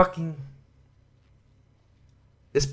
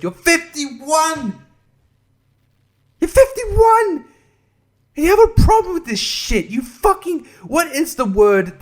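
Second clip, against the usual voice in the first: much higher, louder, slow disbelieving yell; incredulous yell; 14 semitones higher, 6 dB louder, slower than usual. Real, not acted. Ranting at Will Wheaton about his age, in an outraged yell.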